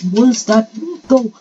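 loud, high cry of disbelief; dismayed outcry. Real, not acted. Player has already fallen in the race, dismayed outcry. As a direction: wail in protest at the fall.

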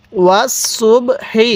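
slow, syllable-by-syllable ওয়াস সুব হি; model recitation. Shown as a demonstration. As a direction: say ওয়াস সুব হি slowly, each syllable separate. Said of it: "এরকমভাবে শিখব".

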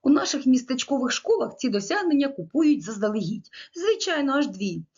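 a hint of a sarcastic small-town teacher; quoting a colleague. Lecturer quoting a teacher's answer about the wall, lightly mocking. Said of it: "отримав такі відповіді".

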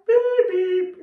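loud alien bleeps; shouting underwater. Shown as a demonstration. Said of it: "let's try saying goodbye".

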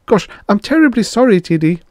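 high, loud nervous apology; flustered assistant. Acted. Frick apologizes to boss.

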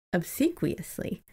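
ordinary talk

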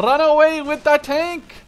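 high, loud, slow shout; loud yell. Real, not acted. Teammate shouting a warning about the tank in a big yell. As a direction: bellow the warning across the field.

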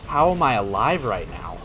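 ordinary delivery

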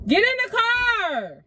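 calling out. Real, not acted. Calling friend to the car.

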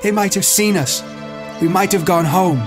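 high-pitched upset accusation; anguished reproach. A narrator's reading. Ralph facing Jack over lost rescue, anguished. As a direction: lament it bitterly, pitched high.

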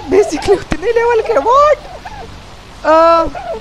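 high-pitched, startled "what". Real, not acted. Gamer startled by the levels on screen, high-pitched surprise.

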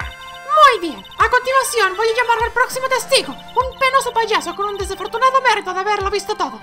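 very high put-on courtroom voice; haughty prosecutor. Prosecutor calls the next witness, high-pitched and formal.

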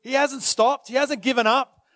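ordinary talk

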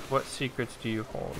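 ordinary talk

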